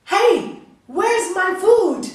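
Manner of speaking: loud, high, slow rude shout; impatient demand. Acted. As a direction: bark for food, impatient. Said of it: "not patiently".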